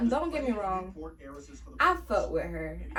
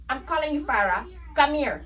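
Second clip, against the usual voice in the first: loud, higher, impatient command; snapping order; 5 semitones higher, 6 dB louder than usual. Retold or imitated. Supervisor snapping at the worker she's been paging, loud. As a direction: call her over like you've run out of patience. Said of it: "Not you snapping on me".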